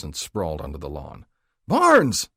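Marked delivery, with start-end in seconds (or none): 1.7-2.3 much higher, loud yell of the name; alarmed yell. A narrator's reading. Rookie yelling at his partner, alarmed. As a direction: yell to stop him. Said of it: "the rookie yelled".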